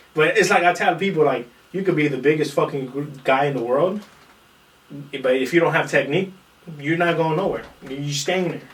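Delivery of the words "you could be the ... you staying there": ordinary delivery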